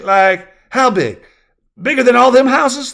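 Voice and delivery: put-on high, loud kid voice; ten-year-old child. A narrator's reading. Child calling to new neighbor, curious kid voice. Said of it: "the boy asked".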